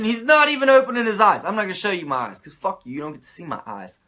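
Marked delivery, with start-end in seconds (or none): none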